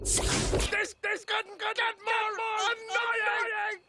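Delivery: high, frantic whining shout; exasperated wail. Acted. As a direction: wail at how maddening it is. Exasperated by echoing selves.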